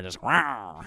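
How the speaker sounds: a mock jaguar rawr, lightly done; jaguar growl. Girl pouncing on Kyle, a mock jaguar growl. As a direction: play a pouncing big cat, half-joking. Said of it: "jumped on him like a jaguar".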